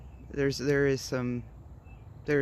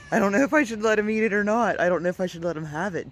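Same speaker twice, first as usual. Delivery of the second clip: high, fast panicked babble; 7 semitones higher, 6 dB louder, much faster than usual. Real, not acted. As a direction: rattle it off in real panic. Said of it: "my panic attack".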